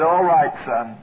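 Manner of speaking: put-on low-pitched, unhurried reply of an old preacher; low, dry quoted preacher; Nicholson. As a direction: drop the voice well down, matter-of-fact. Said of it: "Brother Nicholson".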